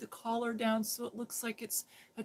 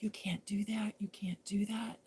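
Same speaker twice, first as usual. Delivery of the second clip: mock whisper; 5 dB quieter than usual. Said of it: "And they're like".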